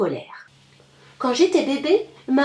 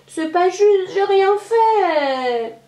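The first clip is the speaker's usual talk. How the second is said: whiny protest; 6 semitones higher, much slower than usual. A narrator's reading. Child throwing a tantrum.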